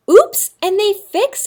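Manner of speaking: bright high oops, lightly shrugged off; cheerful oops. Shown as a demonstration. Teacher modeling the easy fix for kids, light and high. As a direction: chirp it like it's no big deal. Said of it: "All they do is go".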